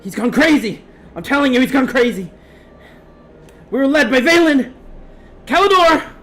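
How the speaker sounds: high, loud, frantic plea; panicked distress call. Acted. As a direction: beg for help in panic. Distress message continues.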